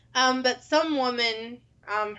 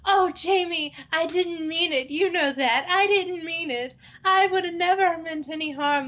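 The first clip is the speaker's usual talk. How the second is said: high, pleading whine; begging for his life; 7 semitones higher than usual. Retold or imitated. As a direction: beg for mercy, voice raised. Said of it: "is begging for his life".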